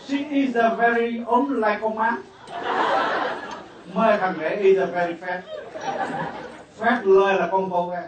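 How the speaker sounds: hammed-up toast from the bride's father; Quảng Nam accented English. Father at daughter's wedding toasts guests, hammed-up Quảng Nam accent. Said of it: "nói tiếng Anh mà ông nói bằng giọng Quảng Nam".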